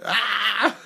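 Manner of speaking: high mock yelp of fright; startled yelp. Dad re-enacting his fright at his son, a high mock yelp. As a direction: replay the jump-scare with a quick high "Ah!". Recalling being scared by son.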